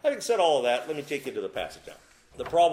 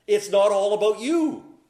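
forceful rebuke; 7 semitones higher, 9 dB louder than usual. Acted. Preacher punches the rebuttal.

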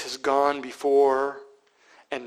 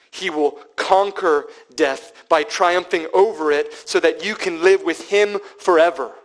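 full-force preaching; 5 semitones higher, 8 dB louder than usual. Real, not acted.

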